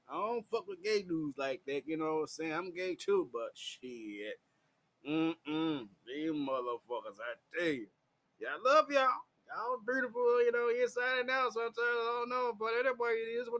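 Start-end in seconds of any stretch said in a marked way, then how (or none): none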